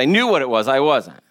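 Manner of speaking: usual speaking voice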